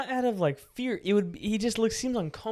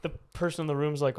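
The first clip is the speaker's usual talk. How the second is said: ordinary talk, nothing marked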